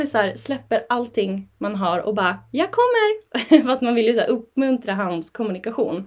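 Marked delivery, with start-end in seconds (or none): 2.5-3.6 much higher, louder, slow called reply; eager calling reply, dropping everything; calling back. Retold or imitated. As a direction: sing out I'm coming to the child. Mother answering child's call.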